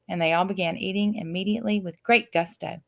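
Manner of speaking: ordinary delivery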